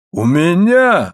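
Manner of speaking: high, drawn-out exclamation; incredulous exclamation. A narrator's reading. Husband hears his figure praised, exclaiming incredulously. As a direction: stretch it upward in disbelief. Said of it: "воскликнул Моррелл".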